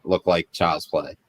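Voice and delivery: ordinary talk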